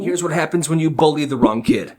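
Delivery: usual speaking voice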